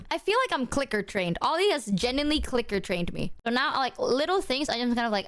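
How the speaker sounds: ordinary talk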